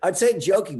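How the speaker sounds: ordinary delivery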